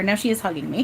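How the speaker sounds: normal talking voice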